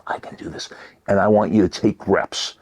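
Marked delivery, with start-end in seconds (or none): 0.1-0.7 barely audible whisper; said to oneself. Shown as a demonstration. Coach showing how to affirm without being overheard, whispered to oneself. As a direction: breathe it privately under the breath. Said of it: "Say it to yourself".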